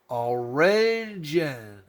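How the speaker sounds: "Origin" drawn out extremely slowly; sounding it out. Acted. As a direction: stretch "Origin" syllable by syllable, like teaching reading. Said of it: "trying our best to read this to you".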